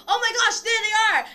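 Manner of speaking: high, loud mock panic; alarmed exclamation. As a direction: act out spotting monsters, shouting. Acts companion spotting the creatures.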